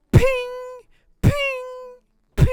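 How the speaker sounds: sharp ringing metal pings; imitated hammer pings. Game master sounding out the forge, imitated pings. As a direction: mimic a hammer on an anvil, evenly spaced. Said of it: "and then you just hear, like".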